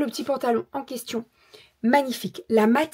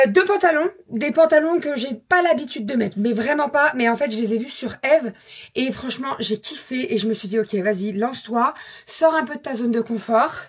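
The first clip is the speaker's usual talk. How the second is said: ordinary talk, nothing marked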